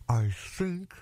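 crocodile talking through clenched teeth; between his teeth. Acted. Crocodile holding the elephant's nose, speaking through teeth. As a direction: force it out between your teeth. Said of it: "he said it between his teeth".

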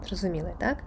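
normal talking voice

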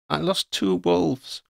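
slow, mildly choked up; a slight catch, slowly. A narrator's reading. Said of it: "a catch in her voice".

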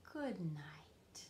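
a soft breathy goodnight whisper. A narrator's reading. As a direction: whisper it gently, barely voiced. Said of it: "and they whisper".

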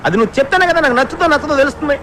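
impatient. Acted. Pressing a hesitant friend to share his idea, impatient. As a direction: press him to come out with it.

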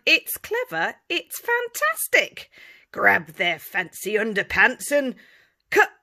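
high, loud glee; excited. A narrator's reading. Storyteller excited about the captain's cunning plan. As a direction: sell the plan with relish.

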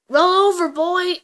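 high, loud order to a horse; loud command. Pretend. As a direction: bark the trick command.

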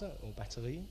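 normal talking voice

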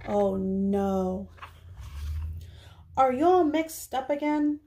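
normal talking voice

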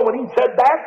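ordinary talk, nothing marked

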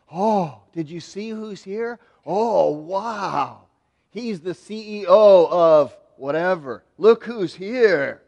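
hammed-up awe, loud and drawn out at the end; mock starstruck. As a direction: gush over a VIP arrival. Fawning over the rich visitor.

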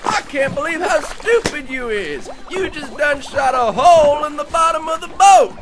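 high, loud angry yell; furious yelling. Acted. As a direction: scream at him for wrecking the boat. Boat shot full of holes.

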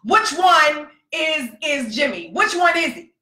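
trying to be cool but about to fight; barely contained fury. Retold or imitated. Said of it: "I'm trying to be cool, but I'm kinda like this".

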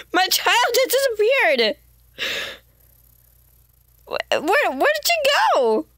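high, hammed-up alarmed calling; mock panic. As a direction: play the frantic parent for laughs. Pet child vanished in game.

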